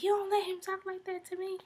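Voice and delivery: high, indignant retold outburst; mock angry. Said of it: "And she got mad".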